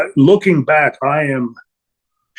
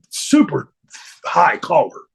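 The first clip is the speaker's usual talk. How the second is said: normal talking voice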